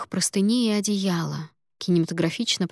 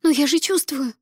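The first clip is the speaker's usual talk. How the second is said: distraught; 9 semitones higher, 5 dB louder than usual. Acted. Patient insists.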